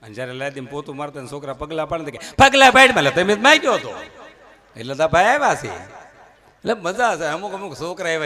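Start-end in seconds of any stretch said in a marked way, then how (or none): 2.4-4.0 scolding mother. Acted. Mother scolds child, floor mopping.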